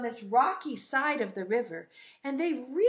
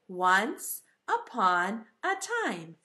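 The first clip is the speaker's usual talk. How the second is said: slow, spaced-out storybook opening; 4 semitones lower, slower than usual. Shown as a demonstration. Teacher showing how fairy tales start, slow storybook delivery. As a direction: lay out each word slowly for children to copy. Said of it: "it starts like this".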